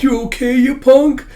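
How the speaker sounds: high, loud tearful wail; mock crying. Tough punk crying over an abandoned dog, mock-tearful wail. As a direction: blubber it, hammed-up. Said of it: "crying. He's like".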